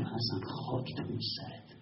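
hushed scolding to himself; whispered self-reproach. Acted. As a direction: whisper it, blaming yourself. (زمزمهٔ سرزنش به خود)

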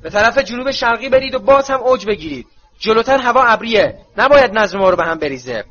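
stern veteran issuing flight orders; Moody's orders. A narrator's reading. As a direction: command the guard firmly. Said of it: "مودی گفت".

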